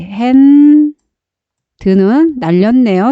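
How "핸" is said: 핸 held long, higher and louder, ending on n; sounding out. Shown as a demonstration. Teacher shows how far to read, 핸 held loud and high. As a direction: sound out 핸 as one stretched, raised syllable. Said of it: "여기까지".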